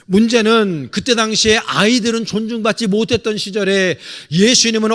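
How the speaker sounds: loud, rousing pulpit delivery; full-force preaching. Acted. Pastor exhorting his congregation, loud and rousing. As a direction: preach it out to the pews.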